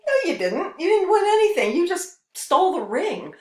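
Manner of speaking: high, loud scolding denial; indignant retort. Retold or imitated. Speaker telling the ring thief off, loud indignant scolding. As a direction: put the boaster firmly in his place. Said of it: "It's like".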